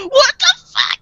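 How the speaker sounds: high, loud laughing yelp; comic high-pitched shock; mock panicked outburst. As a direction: imitate someone freaking out at a monster. Retold man freaking out at monster.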